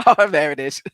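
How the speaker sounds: knowing quote. Retold or imitated. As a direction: deliver it like you saw it coming. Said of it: "was like".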